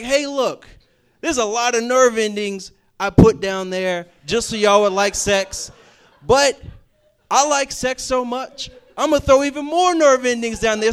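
mock-booming God, loud and high; playful God voice. Preacher voicing God's excited boast to the congregation. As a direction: ham up a gleeful God bragging.

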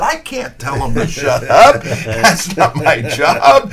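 high, loud, drawn-out mock protest; alarmed protest. Radio man refuses the coach's order, high flustered protest. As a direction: plead that it is out of your hands.

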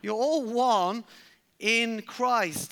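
emphatic call-and-response "one"; leaning on one. Shown as a demonstration. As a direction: stretch "You're all one" slowly for the congregation. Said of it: "Can we say one?".

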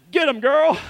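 high, laughing cheer; amused egging-on. Retold or imitated. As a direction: cheer her on, breaking into a laugh.

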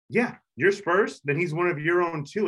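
high-pitched mock British fan; bad British accent. English Spurs fan welcoming an American, mock British accent. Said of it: "that was a really bad British accent".

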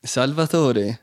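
slow, drawn-out Italian "Salvatore"; Italian accent. Shown as a demonstration. Host gives the Italian version, slow Italian accent. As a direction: savour "Salvatore" like an Italian would. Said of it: "that's Italian".

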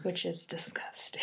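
disgusted whisper. Real, not acted. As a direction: whisper it like it's gross.

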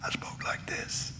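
voiceless, slow whisper; laryngitis whisper. Shown as a demonstration. Preacher showing his congregation his laryngitis, hushed whisper. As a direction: whisper as if the voice is gone. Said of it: "I had laryngitis".

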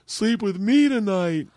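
put-on high, slow child's whine; dad imitating his daughter's tearful whine; imitating crying daughter. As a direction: mimic a tearful little girl. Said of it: "She's like".

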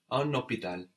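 "un hôpital" linked with a sounded N; obligatory liaison. Shown as a demonstration. Teacher explains the required link, "un hôpital" joined by N. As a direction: carry the N of "un" into "hôpital". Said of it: "obligatory liaison there between un and hôpital".

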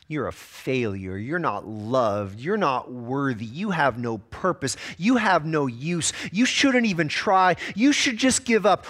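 the accuser pressing lies, slowly building; accuser's lies. Acted. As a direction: lay out each damning lie deliberately, mounting in force. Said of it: "spiritually whispering lies into your mind".

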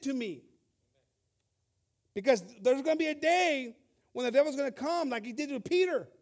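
God's voice thundered from the pulpit; fiery preaching. Acted. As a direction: press the church hard, building. Preaching at full force.

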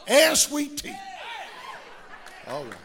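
high laughing shout of and sweet tea; real laugh on the tag line; laughing joke. Real, not acted. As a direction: toss the punchline up with a laugh. Joking aside with laugh.

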